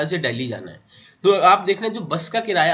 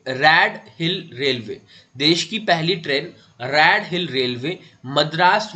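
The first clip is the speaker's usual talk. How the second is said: slow dictation; slower than usual. Shown as a demonstration. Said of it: "teacher dictating key fact".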